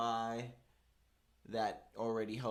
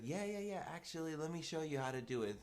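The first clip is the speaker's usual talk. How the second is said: put-on higher-pitched voice of an obliging older lady; helpful lady imitation; 5 semitones higher, 3 dB quieter than usual. Older lady stepping in for new clerk, imitated helpfully. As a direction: breezily step in to help, imitating her.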